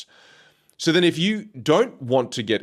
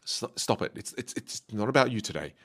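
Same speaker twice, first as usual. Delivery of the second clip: self-talk; 7 dB quieter than usual. Retold or imitated. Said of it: "I just think to myself".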